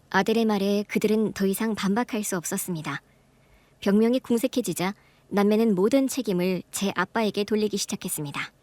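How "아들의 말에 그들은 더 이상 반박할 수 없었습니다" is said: ordinary delivery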